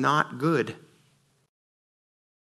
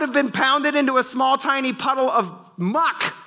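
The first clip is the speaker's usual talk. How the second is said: full-force preaching; 12 semitones higher than usual. Real, not acted. Pastor rebuking harsh church, high impassioned preaching. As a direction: preach it hard, voice climbing.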